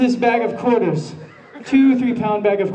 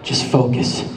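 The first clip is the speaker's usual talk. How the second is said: calm; 6 semitones lower than usual. Retold or imitated. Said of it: "I'm a martial artist".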